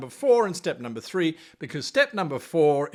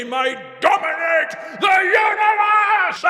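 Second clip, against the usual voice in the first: much higher, loud, sung out, then an evil cackle; villainous declaration; 13 semitones higher, 9 dB louder than usual. Acted. Teacher hamming a villain plan to GMs, sung out with a cackle. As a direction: belt out the world-domination plan with a mad laugh.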